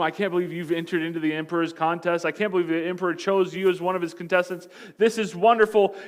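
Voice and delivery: brisk, thrilled father's praise; proud excited father. Acted. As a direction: gush with pride over the boy. Proud father praising his son.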